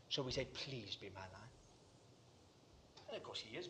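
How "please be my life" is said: low, very soft imitated prayer; tentative plea. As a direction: plead quietly, without assurance.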